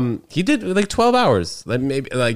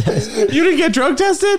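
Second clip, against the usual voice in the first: high, slow, laughing disbelief; much slower than usual. Real, not acted. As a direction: crack up while asking it. Shocked by lifeguard confession.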